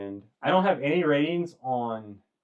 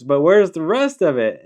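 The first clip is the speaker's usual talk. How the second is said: higher, louder puzzled boss; employer; 8 semitones higher, 10 dB louder than usual. Retold or imitated. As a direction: press him, pitched up. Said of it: "he goes".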